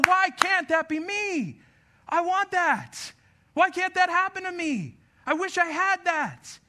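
high, drawn-out mock lament; envious complaint. Preacher mimics people eyeing someone else's life, envious complaint. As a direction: stretch it out in exaggerated longing.

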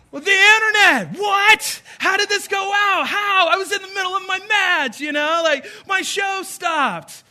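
high, loud panicked yelling; kids freaking out. Acted. Kids yell at dad after the internet goes off, panicked shouting. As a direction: panic as your game cuts out.

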